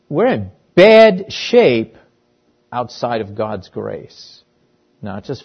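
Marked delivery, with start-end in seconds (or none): 0.1-2.0 higher, louder, slowed right down; preacher pressing the point slowly; slow emphatic. Acted. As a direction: drag out bad shape for weight.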